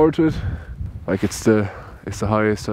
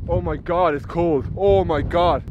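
ordinary delivery

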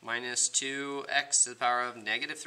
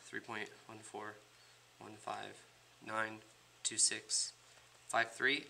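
much softer, hesitant digits; nervous quiet; 3 semitones lower, 12 dB quieter, slower than usual. Real, not acted. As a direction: recite pi with stage fright. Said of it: "I have stage fright right now".

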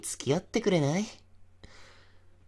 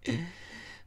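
sinister chuckle; 6 semitones higher, 8 dB quieter than usual. Acted. Before revealing murder.

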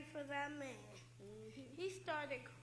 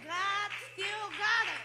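loud preaching, high and ringing; 5 semitones higher, 11 dB louder than usual. Real, not acted. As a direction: proclaim it to the congregation. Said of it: "He's a preacher right there".

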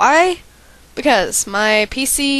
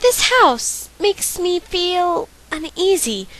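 character voice; 5 semitones higher, slower than usual. Acted. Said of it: "why do I feel the need to do voices".

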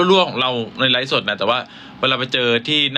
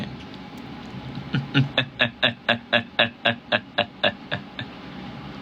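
soft breathy evil chuckle; a quiet sinister villain laugh; 2 semitones lower, 7 dB quieter than usual. Acted. Villain laughing menacingly at the hero, breathy. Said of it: "หัวเราะแบบตัวร้ายด้วย".

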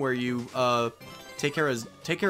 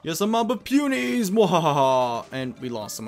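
higher, louder cartoon villain voice; slow theatrical villain menace; 6 semitones higher, 9 dB louder, slower than usual. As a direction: ham up the gloating villain. Voices villain in game dialogue.

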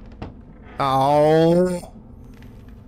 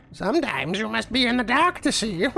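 mock-wise quote; 8 semitones higher than usual. Mimics game's quote.

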